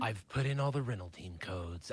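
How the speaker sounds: low, dramatic trailer narrator; movie trailer guy. Pretend. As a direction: announce it like an epic movie trailer. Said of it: "I'm talking like a, a movie trailer guy".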